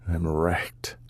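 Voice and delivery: broken whisper. Acted. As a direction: whisper it, defeated. He speaks to the table.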